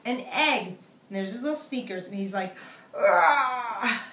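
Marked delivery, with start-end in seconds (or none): 2.9-4.0 loud groaning yawn; big yawn. Acted. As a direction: stretch into a noisy yawn.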